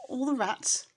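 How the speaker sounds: ordinary delivery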